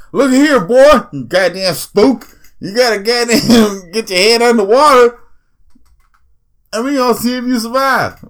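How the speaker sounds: high, loud mock drill-instructor bark; hammed-up De Niro drill sergeant yelling at the recruit; De Niro impression. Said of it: "Robert De Niro was just like".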